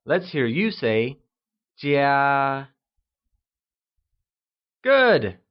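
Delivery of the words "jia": "jia" held long on a level tone; high level tone. Shown as a demonstration. Teacher models the Mandarin word for home, "jia" in high level tone. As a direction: draw out "jia" without dropping the pitch. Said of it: "pronounced using the high level tone".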